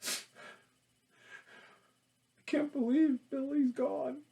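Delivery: melodramatic fake crying; exaggerated tearful moan; overacted grief. Shown as a demonstration. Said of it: "It's bad".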